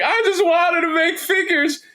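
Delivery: squeaky-high mocking voice; mock Brian. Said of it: "And he was just like".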